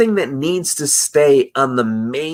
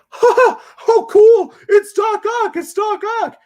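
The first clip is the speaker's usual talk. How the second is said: high-pitched fake fan squeal; mocking excited fan; 5 dB louder than usual. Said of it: "just so we could be like".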